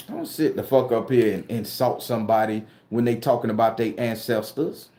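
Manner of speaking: ordinary talk, nothing marked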